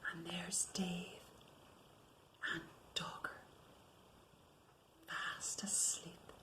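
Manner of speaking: hushed whisper. Real, not acted. Reading to children, whispering over the sleeping pair. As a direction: whisper so the sleepers stay asleep.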